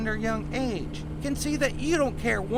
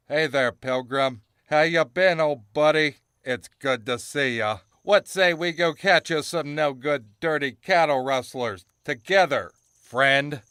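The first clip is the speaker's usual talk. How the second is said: much lower, folksy buddy cowboy voice; 10 semitones lower than usual. Retold or imitated. [name] mocking John's chummy talk with the outlaw in a low cowboy voice. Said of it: "you was all like".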